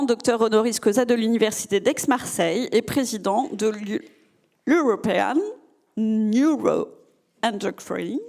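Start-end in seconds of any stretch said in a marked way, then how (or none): 4.7-8.2 French speaker stumbling through English words, drawn out slowly; clumsy English accent. Real, not acted. Hosting the festival, introducing the doctor's English title in halting English accent. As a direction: say the English name in a shaky French-tinged English accent. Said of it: "excusez mon accent anglais un peu pitoyable".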